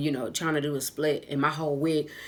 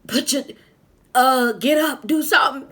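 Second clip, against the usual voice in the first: higher, louder mock panicked man; flustered customer; 9 semitones higher, 9 dB louder than usual. Customer urging the fallen dancer, higher and louder. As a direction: imitate him urging her up. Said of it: "And he like".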